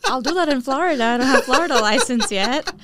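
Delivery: giggling as she talks; laughing. Real, not acted. Agent laughing off a referral request, laughing. Laughing while answering about license.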